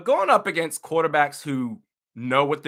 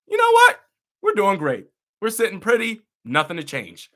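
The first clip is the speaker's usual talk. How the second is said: high, loud mock cheerfulness that settles back down; opens much higher and louder, then fades to the usual voice; mock complacent coordinator; 6 semitones higher, 6 dB louder than usual. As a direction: parody a smug coach who thinks all is fine. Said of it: "think to yourself".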